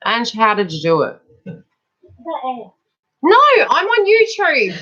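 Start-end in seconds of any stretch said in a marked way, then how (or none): 1.4-4.7 high, slow, drawn-out exasperation; exasperated plea. Real, not acted. Mother begging daughter to go, high and drawn out.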